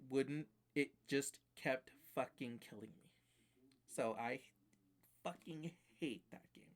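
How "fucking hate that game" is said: soft, higher-pitched, seething frustration; restrained loathing. Real, not acted. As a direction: squeeze out your hatred quietly. Venting hatred of the game.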